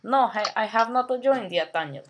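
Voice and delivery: thick put-on Russian accent, broken English; heavy Russian accent menace. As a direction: play a comic Russian gamer with a heavy accent. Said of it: "Shut up, talking like that, you sound stupid".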